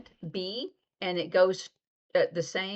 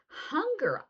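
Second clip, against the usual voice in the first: drawn-out hunger; 10 semitones higher than usual. Shown as a demonstration. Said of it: "So it's a C hand shape".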